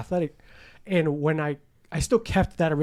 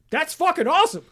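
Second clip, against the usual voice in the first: much higher, excited; delighted; 12 semitones higher, 5 dB louder than usual. Retold or imitated. Cheering on friends who want to join the gym. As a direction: burst out with delight.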